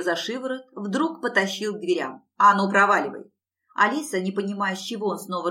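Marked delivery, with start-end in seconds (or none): 2.4-3.3 loud, angry dismissal; angry order. Acted. Claude dragging Alisa to the door, angry order. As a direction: throw someone out with a loud command.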